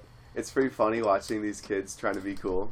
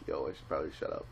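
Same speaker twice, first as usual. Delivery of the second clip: mumbled aside; 7 semitones lower, 10 dB quieter, faster than usual. Retold or imitated.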